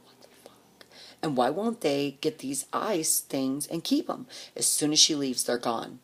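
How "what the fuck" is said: hushed what the fuck; shocked whisper; whispered disbelief. Retold or imitated. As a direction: whisper it, baffled. Stunned whisper at the ice water.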